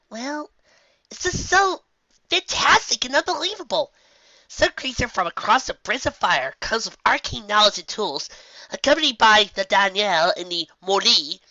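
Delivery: a filly gushing about a fantastic legend; excited young filly. Acted. Filly voiced by reader, excited.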